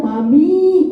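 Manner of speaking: "Mami" dengan i panjang, lebih keras; drawn-out Mami. Retold or imitated. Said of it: "I-nya banyak". (meniru anak ketiga memanggil "Mami" dengan i panjang dan keras)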